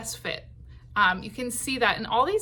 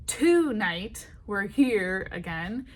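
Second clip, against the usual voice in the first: first syllable of "Tonight" drawn out as a heavy TOO-night; slow demo with TO- stressed in "Tonight"; wrongly stressed TONIGHT; 3 dB louder, slower than usual. Shown as a demonstration. Songwriting teacher shows learners the wrong meter, TO-night stressed on the first syllable. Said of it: "We don't go".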